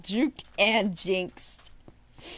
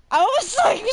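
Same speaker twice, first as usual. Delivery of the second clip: laughing disbelief; 12 semitones higher, 6 dB louder, faster than usual. Real, not acted. Teacher laughing at a student's audacity, high and loud. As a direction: burst of high laughter mid-sentence. Flabbergasted at student calling bluff.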